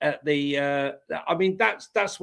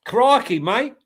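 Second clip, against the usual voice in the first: mock Australian; 5 semitones higher, 6 dB louder than usual. Mock Aussie after Australian lad bit.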